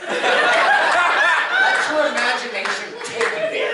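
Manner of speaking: high, slow teasing invitation; lightly hammed-up seduction; mock dreamy. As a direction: draw it out dreamily. Comic set-up to audio.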